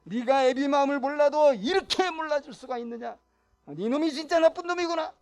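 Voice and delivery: loud, high stern rebuke; scolding father. Retold or imitated. Patriarchal father scolding his eldest son, loud and stern. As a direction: give the son a harsh dressing-down. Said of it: "이렇게 막 하면서".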